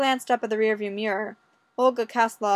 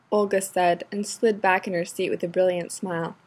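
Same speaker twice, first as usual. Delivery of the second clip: ordinary delivery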